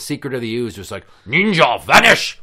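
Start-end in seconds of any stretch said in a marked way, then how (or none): 1.3-2.4 loud, higher theatrical exclamation; punchy mock battle cry; mock ninja cry. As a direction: call it out like a cartoon ninja escape. Mimicking a ninja's vanishing cry.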